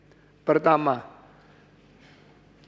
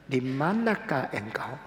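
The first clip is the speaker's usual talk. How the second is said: soft slow question; 4 semitones lower, 7 dB quieter than usual. Shown as a demonstration.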